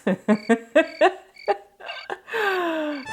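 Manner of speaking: loud high burst of laughter. Real, not acted. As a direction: crack up at yourself. Laughing at her shade.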